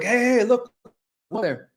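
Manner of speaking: imitated calling out. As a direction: call out like an alarmed bystander, not full volume. Bystanders' shouts acted in retelling.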